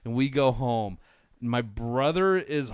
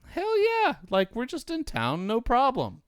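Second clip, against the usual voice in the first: much higher, gleeful mock enthusiasm; eager yes; 11 semitones higher than usual. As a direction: squeal a cocky "why not" like a wild teen. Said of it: "And she's like".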